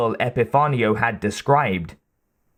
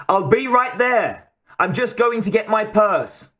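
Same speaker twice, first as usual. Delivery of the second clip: put-on much higher young girl, Boston accent; 10 semitones higher than usual. A narrator's reading. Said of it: "a young voice with a Boston accent said".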